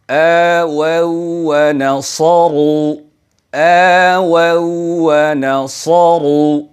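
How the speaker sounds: slow melodic chant of আওয়াউ ওয়া নাসারু; Quranic recitation. Shown as a demonstration. Teacher recites an example verse for viewers, chanted slowly. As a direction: recite it slowly with a rounded waw. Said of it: "আর একটি জায়গা আছে".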